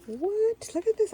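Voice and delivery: high, loud burst of surprise; astonished. Real, not acted. Crafter discovering a straw bat, shouting in surprise. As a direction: jump up in amazement.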